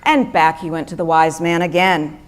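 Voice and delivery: ordinary delivery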